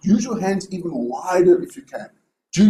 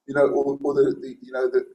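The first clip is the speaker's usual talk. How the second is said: ordinary delivery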